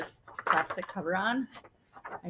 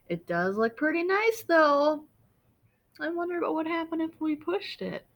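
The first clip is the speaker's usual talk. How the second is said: higher, curious and tempted; 6 semitones higher than usual. Acted. Larry eyes the button, higher and tempted. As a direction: wonder aloud, drawn to the button.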